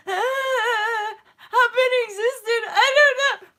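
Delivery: high, loud, cracking laugh-cry; laughing through tears. Retold or imitated. Vlogger acting out her breakdown, laughing and crying. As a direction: laugh helplessly while tearing up. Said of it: "And I'm just like".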